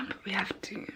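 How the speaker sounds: fast, soft early-morning whisper. Real, not acted. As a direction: whisper so nobody wakes up. Early morning, others asleep.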